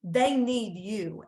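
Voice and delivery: normal talking voice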